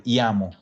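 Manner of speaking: iamo with one short i and falling close; iamo, crisp single i, no double vowel. Shown as a demonstration. Teacher repeating the right form for students, single short i. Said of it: "Sin, sin ninguna doble".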